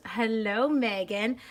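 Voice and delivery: normal talking voice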